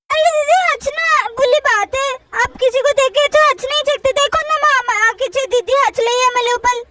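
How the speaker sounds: put-on lisping tattletale kid; little girl Chutki, complaining. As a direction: complain to mom in a babyish voice. Chutki complains about sister.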